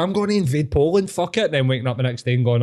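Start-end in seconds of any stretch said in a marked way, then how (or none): 0.0-1.5 mock passionate declaration, pitched up; high, hyped-up party bravado; Hitler. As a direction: announce a wild plan like a wired party rambler. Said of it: "why everything was so passionate".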